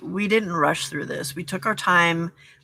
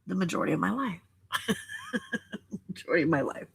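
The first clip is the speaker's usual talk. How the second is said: laughing softly through the words; rueful laugh at herself; a self-mocking chuckle as she repeats it; 8 dB quieter, much faster than usual. Real, not acted. Laughs at her own words.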